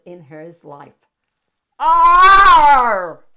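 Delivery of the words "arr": a huge, high, booming lion roar; loudest lion roar. A narrator's reading. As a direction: roar with everything you've got. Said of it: "he roared the loudest roar".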